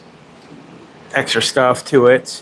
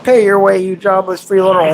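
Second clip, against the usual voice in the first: mock scolding; 6 semitones higher than usual. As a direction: playfully order a freeloader to earn their keep. Mock scolding of dog, repeated.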